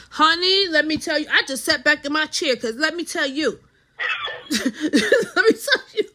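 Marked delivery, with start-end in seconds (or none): 4.0-6.0 higher, slow words through laughter; laughing. Real, not acted. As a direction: crack up while trying to speak. Cracking up at co-host's remark.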